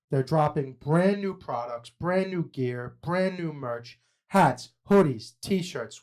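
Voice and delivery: punchy promo read with stretched key words; sponsor ad read. Acted. Host pitching merch drops and a discount code to listeners, ad read. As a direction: sell it to the listeners, spelling things out slowly.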